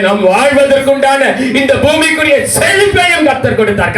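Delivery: preaching that climbs to a high, urgent pitch; fervent preaching. Real, not acted. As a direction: exhort the congregation, pitch soaring as you go. Preaching at full force. (உணர்ச்சி பொங்க உயர்ந்த குரலில் பிரசங்கம்)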